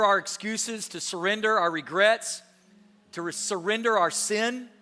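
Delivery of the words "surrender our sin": normal talking voice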